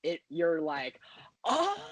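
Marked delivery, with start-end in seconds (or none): 1.5-1.8 high, drawn-out mock "oh"; mock gasped realization. Movie fan acting out the audience's realization, mock shocked. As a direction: let it leap up high in imitation of shock.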